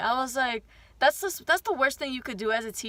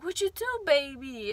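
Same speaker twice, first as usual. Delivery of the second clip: gentle teacher; 3 semitones higher than usual. Retold or imitated. Kind teacher gently asking a crying child, tender.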